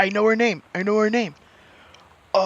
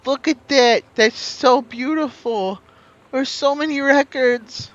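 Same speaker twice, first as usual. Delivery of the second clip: high, loud gushing wonder; thrilled, stretched-out exclaiming; excited delight; 8 semitones higher, 6 dB louder than usual. Real, not acted. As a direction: gush over a lovely find. Delighted by a find.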